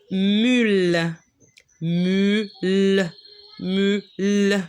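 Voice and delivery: "mule" said slowly with its final l sounded; syllable drill. Shown as a demonstration. Teacher repeating a word for pupils to echo.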